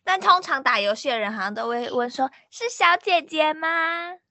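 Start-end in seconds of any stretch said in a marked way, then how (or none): 2.5-4.2 slow drawn-out "是小姐姐吗"; mock gamer asking slowly; slow teasing question. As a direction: stretch it out teasingly. Gamers ask if girl.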